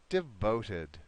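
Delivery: "devoted" with short "de", stressed VOTE, clear "-ed"; pronunciation model. Shown as a demonstration. Teacher has student try again, careful model of "devoted". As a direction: model "devoted" with a short, reduced first syllable. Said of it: "Try this again for me one more time".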